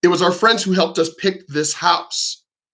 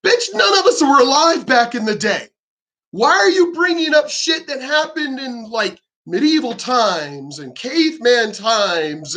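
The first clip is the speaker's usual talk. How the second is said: angry rant; 5 semitones higher than usual. Real, not acted. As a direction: let it fly at them. Ranting at feminists.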